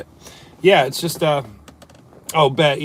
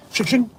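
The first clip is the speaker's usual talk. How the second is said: knife swish sound; 10 semitones higher than usual. Pretend. Imitating a knife slash.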